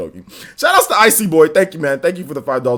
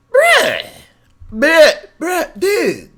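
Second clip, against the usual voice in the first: high, loud incredulous bruh; exasperated bruh; 12 semitones higher, 5 dB louder, much slower than usual. Real, not acted. Streamer baffled by a viewer's abbreviation, high incredulous bruh. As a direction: cry out in disbelief at a dumb abbreviation.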